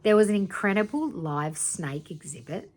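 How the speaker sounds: usual speaking voice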